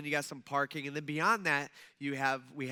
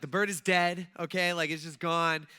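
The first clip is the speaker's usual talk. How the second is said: louder, blunt announcement; blunt retelling; 5 dB louder than usual. Retold or imitated. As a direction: blurt it out with no tact. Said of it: "But instead, I'm like".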